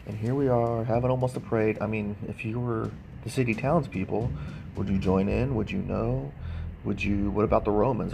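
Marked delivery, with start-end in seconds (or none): none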